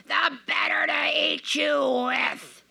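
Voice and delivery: slow breathy menacing hiss; the wolf finally bares his teeth; a whispered, slow wolf's threat. Acted. Wolf reveals itself.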